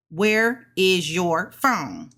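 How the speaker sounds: Where is your phone said very slowly; careful teacher pace; slow teaching read. Shown as a demonstration. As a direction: spell the question out slowly.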